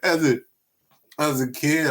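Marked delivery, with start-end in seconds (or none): 0.0-0.4 high-pitched laughing through words; can barely talk for laughing. Real, not acted. Remembering the joke, cracking up mid-sentence. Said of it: "laughing at the memory".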